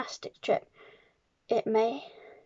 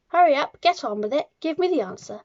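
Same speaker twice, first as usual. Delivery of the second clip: raised, louder, hurrying voice; impatient demand; 5 semitones higher, 7 dB louder than usual. A narrator's reading. Headmistress presses Wilfred for the sum, impatient. Headmistress hounding pupil for answer.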